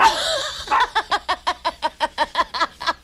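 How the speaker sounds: laughing hard at her own joke; high laugh. Real, not acted. Big laugh after own joke.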